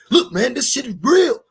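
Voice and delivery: loud, high, pushy mock liar; aggressive lie. The devil pushing a lie hard, loud and high. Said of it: "Nobody's gonna tell you an aggressive lie".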